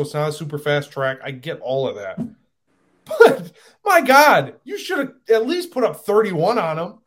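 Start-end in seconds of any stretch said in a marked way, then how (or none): 3.1-7.0 high, loud, drawn-out exasperation; worked-up fan venting; exasperated outburst. Real, not acted. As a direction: stretch out "my God" in loud disbelief. Exasperated rant about his team.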